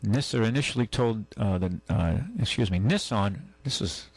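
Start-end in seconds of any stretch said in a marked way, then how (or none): none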